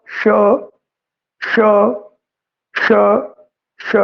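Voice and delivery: ਸ਼ as a long voiceless hush, repeated; hissed sound demo. Shown as a demonstration. Teacher demonstrating ਸ਼ to students. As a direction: push out each ਸ਼ as a breath. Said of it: "ਜਿਵੇਂ ਆਪਾਂ ਉਚਾਰਨ ਕਰਦੇ ਹਾਂ".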